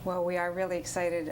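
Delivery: ordinary delivery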